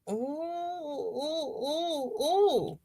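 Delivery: string of high, soft oohs; reacting oohs. Host replaying her reaction to a clip, soft high oohs. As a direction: coo high, drawn-out mock oohs. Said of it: "I'm sitting here going".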